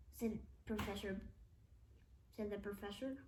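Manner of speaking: ordinary delivery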